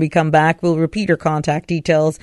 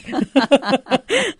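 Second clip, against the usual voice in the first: short laugh; 7 semitones higher than usual. Real, not acted. Host laughs in studio.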